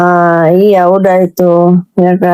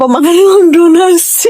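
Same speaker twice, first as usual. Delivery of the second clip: mock accusation; 7 semitones higher than usual. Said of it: "dibilang apa".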